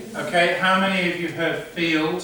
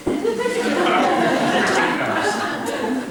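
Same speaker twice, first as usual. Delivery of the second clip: high, slow, laughing tease; laughing mock teacher; much slower than usual. Shown as a demonstration. Teacher teasing pupils, laughing and high. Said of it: "you can say".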